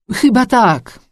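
strained, high, loud reply; effortful reply; answering with effort. A narrator's reading. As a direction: force the answer out with difficulty. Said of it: "odpowiedziałam z trudem".

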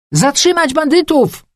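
high, loud battle cry; indignant high-pitched yell; rallying shout. A narrator's reading. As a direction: call out to rouse the crowd. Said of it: "zawołałam, wygrażając pięścią".

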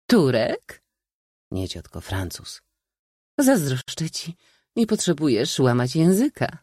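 high chattering voice, dropping to a whisper; gossiping aunt. A narrator's reading. Aunt gossiping about the valet, high chatter. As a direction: prattle then lean in.

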